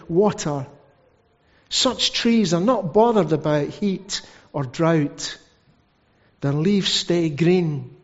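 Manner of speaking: slow, deliberate Bible reading; measured pulpit reading with weighty pauses; scripture reading. Acted. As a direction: read the verse out slowly, pausing between phrases. Reads scripture aloud.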